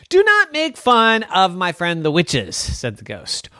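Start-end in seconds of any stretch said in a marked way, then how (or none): 0.1-2.6 high, loud protest; indignant scolding. Acted. Ghost defending witch friends, acted indignation. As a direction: scold him in mock outrage.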